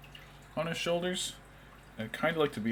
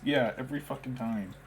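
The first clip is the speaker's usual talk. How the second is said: ordinary delivery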